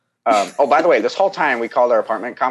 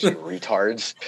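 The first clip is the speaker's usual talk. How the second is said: laughing insult; 4 semitones higher, 4 dB quieter, much slower than usual. Retold or imitated. Mocking the on-site staff.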